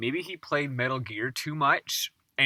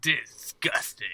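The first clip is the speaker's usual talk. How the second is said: put-on Transylvanian accent; 3 semitones higher, slower than usual. Continuing the vampire voice.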